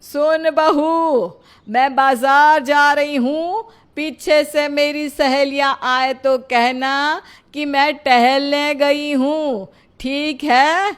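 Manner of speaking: loud, slow and deliberate; speaking up. Acted. Mother-in-law to hard-of-hearing bahu, loud and slow. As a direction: spell it out loudly for someone hard of hearing. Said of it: "आप थोड़ा ऊंचा बोलिए ना".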